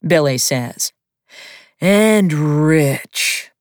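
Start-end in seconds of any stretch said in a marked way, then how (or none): 1.8-3.5 sulky. A narrator's reading. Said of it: "Sweeney adds in a sulky tone".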